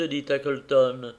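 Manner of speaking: usual speaking voice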